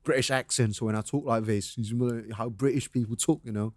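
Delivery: low, soft British accent; offhand British impersonation. Shown as a demonstration. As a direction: demonstrate a British accent quietly. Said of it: "jago impersonate accent".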